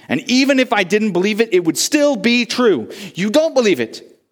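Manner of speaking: heated retort. A narrator's reading. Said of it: "Shepherd said".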